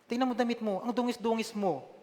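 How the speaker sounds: high-pitched scolding mother; angry mother. Retold or imitated. Classmate's mother scolding the boy, high-pitched angry rebuke. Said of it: "galit na galit, sabi niya".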